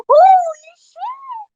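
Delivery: loud, high, drawn-out stunned cry; shocked exclamation. Real, not acted. Streamer stunned by what Belfast built, loud slow shock. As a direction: let it burst out slowly in disbelief.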